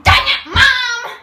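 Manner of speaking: high, loud, slow groan at Mom; exasperated teen yell; fed-up shout at Mom; annoyed outburst. Acted. Notification was only Mom.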